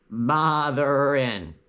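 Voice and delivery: slow, drawn-out bleating sheep voice; sheep saying botherin'. Retold or imitated. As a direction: stretch it out like a bored sheep bleating. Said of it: "it sounded like they were constantly saying".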